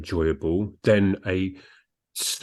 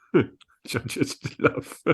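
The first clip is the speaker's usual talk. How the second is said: breathy laughing aside, slowed; 4 semitones higher, 3 dB quieter, much slower than usual. Real, not acted. Amused by a one-line review, laughing. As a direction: laugh through the words.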